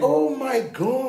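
high, drawn-out laughing exclamation; laughing disbelief. Real, not acted. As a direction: draw it out through laughter. Cracks up at racism joke.

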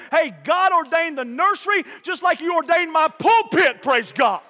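high, forceful pulpit shout; rousing preacher's climax; emphatic preaching. Real, not acted. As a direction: drive it home to the congregation.

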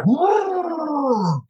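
high alarmed whoa; plane lurching. Retold or imitated. Acting out the plane's sudden drop, high alarmed cry. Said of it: "That plane said".

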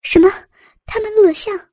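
high, panicked question; horrified disbelief, pitch jumping up; alarmed. A narrator's reading. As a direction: blurt it out in dread. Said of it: "林燕惊恐地问道".

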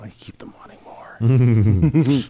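slow, whispered villain scheming; slow theatrical villain menace. Pretend. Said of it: "My nefarious plot".

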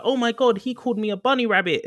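high-pitched mock offended cry; mock outrage. Joking with co-hosts as someone insulted by a silly word, mock outrage. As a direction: act scandalised, pitched well up.

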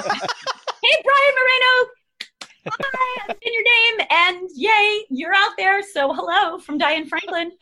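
high, bubbly greeting to a fan; excited shout-out. Pretend. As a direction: beam a cheery hello up high. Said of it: "also ask her for a shout of".

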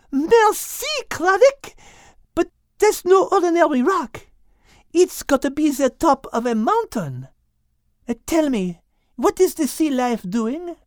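put-on very high, eager French-accented voice; very high thrilled French accent; excited French. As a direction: Liz jolted with energy.

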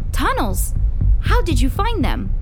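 high, loud, astonished questions; amazed questioning. Acted. Friend hears of hidden tunnels, amazed. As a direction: react wide-eyed, voice jumping up.

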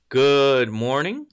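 usual speaking voice

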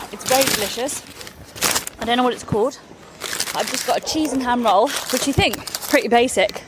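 normal talking voice

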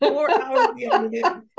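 high, slow words through real laughter; laughing. Real, not acted. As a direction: say it while still cracking up. Laughing while retelling husband's restraint.

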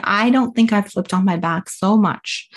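usual speaking voice

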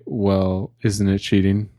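normal talking voice

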